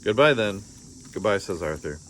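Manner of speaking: usual speaking voice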